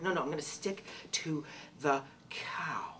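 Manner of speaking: soft unvoiced whisper to herself; whispering her stubborn choice to stay a cow; whispered thought. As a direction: whisper it like a private decision. Retold inner thought, whispered.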